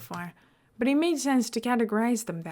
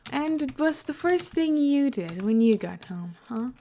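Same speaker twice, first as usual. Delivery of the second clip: sweetly teasing; 6 semitones higher than usual. A narrator's reading. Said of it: "Scar asked him sweetly".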